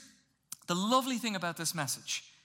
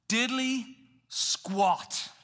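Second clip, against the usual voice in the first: drawn out; 4 semitones higher, 4 dB louder, much slower than usual. Acted. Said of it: "Anyone know?".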